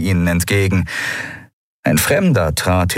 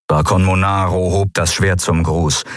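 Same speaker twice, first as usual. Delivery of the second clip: usual speaking voice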